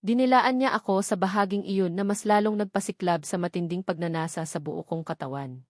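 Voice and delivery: usual speaking voice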